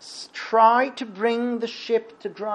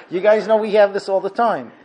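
usual speaking voice

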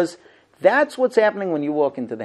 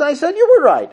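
high insistent; 9 semitones higher, 7 dB louder than usual. Retold or imitated. Wife insists.

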